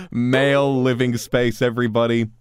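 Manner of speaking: loud, high, slow proclamation; mock announcement. Player announces the scene to everybody, loud mock announcement. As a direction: draw it out and call to everyone.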